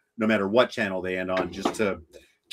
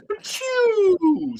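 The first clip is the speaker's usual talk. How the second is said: mock sneeze. Sneezing out the name to tease the listener, mock sneeze. Fake sneeze about Hong Kong.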